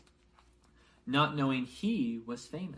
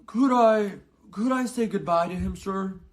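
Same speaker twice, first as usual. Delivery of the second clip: much higher, louder pleading giant; Hagrid asking; 9 semitones higher, 12 dB louder than usual. A narrator's reading. Hagrid begs Dumbledore to say goodbye, high and loud. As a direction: beg to say goodbye, voice pitched way up. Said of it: "asked Hagrid".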